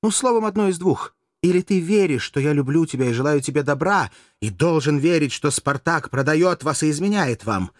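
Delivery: high, loud, impassioned reproach; vehement, theatrical scolding; heated accusation. A narrator's reading. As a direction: press the charge home with indignation. Courtesan angrily presses her accusation.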